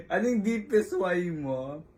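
ordinary delivery

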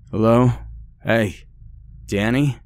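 calling out for someone unseen; mild yell. A narrator's reading. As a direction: call into the next room, questioning. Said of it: "I yelled".

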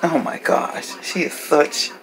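soft, exasperated under-breath mutter. Real, not acted. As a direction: grumble it half to yourself. Muttering about the dog.

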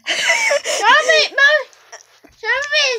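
high pained shout; crying out. Real, not acted. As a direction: yell out from the sourness. Sour candy torment, crying out.

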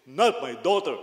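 loud, booming fatherly refusal; big baritone voice. Retold or imitated. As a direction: boom it like a strict pastor. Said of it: "With a big baritone voice".

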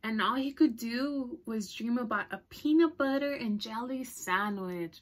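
ordinary delivery

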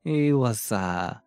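halting fake French accent, slipping toward Russian; struggling French accent. Recalling a vampire character for viewers, struggling French accent. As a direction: put on a shaky-footed French accent, searching for words. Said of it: "let me see if I can even do it".

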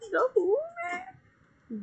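high, slow, squeaky "who man"; squeaky confused question. Acted. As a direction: play dumb in a squeaky voice. Mock confused villain repeating.

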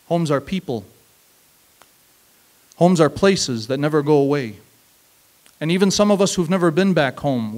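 usual speaking voice

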